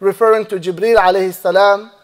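usual speaking voice

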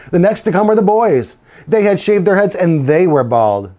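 lively picture-book read-aloud; reading to children. A narrator's reading. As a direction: tell it to a class of kids.

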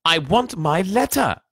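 slow, high, loud demand; shout. A narrator's reading. Boy demanding his letter, shouting. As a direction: shout each word stubbornly. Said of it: "he shouted".

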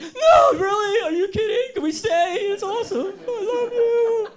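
very high, loud, sobbing joyful cry; overjoyed crying shout at his wife; tears of joy. Retold or imitated. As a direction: shriek it through happy tears. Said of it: "sobbing, crying, tears of joy".

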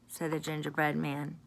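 usual speaking voice

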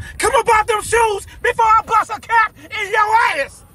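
high, slow, shouted threat; furious shout. Acted. Robber demands the jersey, slow high furious shout. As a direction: spit each word out slowly at him.

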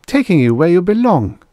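firm. A narrator's reading. Ida insisting to the man who stopped her, firm. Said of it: "Ida said firmly".

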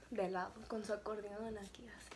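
a whispered description, voice dropped right down; hushed, almost no voice at all; whispered aside. Real, not acted. Telling her partner the story, whispered detail of him standing there.

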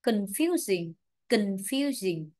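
"confusing" with stress on FU; con-FU-sing, carefully modelled "confusing"; model word stress. Shown as a demonstration. Teacher models standard reading for the class, model word stress. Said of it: "đánh trọng âm để chúng ta đọc cho nó chuẩn".

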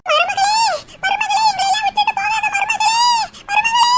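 a hammed-up mother-in-law's wail; mock wailing plea. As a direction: wail after her as she leaves. Mother-in-law begs daughter-in-law stay.

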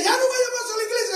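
high, fast nagging voice; the devil urging someone to skip church, high and fast; devil's taunt. Retold or imitated. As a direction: rush the temptation out high. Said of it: "cuando te diga".

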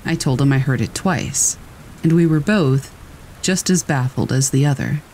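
ordinary delivery

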